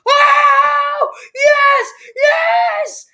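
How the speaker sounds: very high, loud shouts of joy; ecstatic shout. Retold or imitated. Fans flipping out over Green Goblin, screaming with joy. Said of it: "be like".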